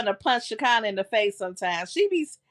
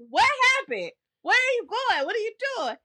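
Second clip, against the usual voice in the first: much higher, baffled questions; confused kid; 12 semitones higher than usual. Retold or imitated. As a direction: play your younger self lost and calling after him. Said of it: "I was like".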